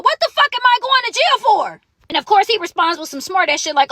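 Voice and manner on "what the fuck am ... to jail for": high-pitched retold outrage; indignant protest. Detainee protesting to the officer about jail, indignant. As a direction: protest it in disbelief.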